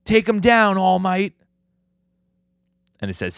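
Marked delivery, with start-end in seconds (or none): 0.0-1.3 high, loud mock rallying shout; hammed-up cheer. Vlogger voices Stain cheering All Might on, high loud shout. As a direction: yell it up high like a fanatic cheering. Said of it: "And he goes".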